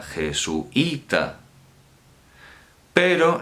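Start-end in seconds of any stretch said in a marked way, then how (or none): 0.0-1.4 "jesuita" drawn out slowly, je-su-Í-ta; "jesuita" very slow, in four syllables; stressed closed vowel. Shown as a demonstration. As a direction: break "jesuita" between u and i, leaning on the í. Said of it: "estamos acentuando la más cerrada".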